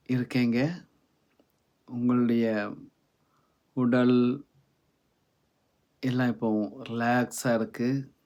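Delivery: slow, level relaxation voice with long pauses; guided meditation. Acted. Host relaxing listeners' bodies from head downward, guided meditation. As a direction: ease them through it phrase by phrase, stretching words.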